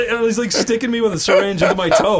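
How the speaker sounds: usual speaking voice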